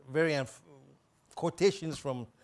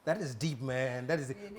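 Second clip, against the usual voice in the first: ordinary delivery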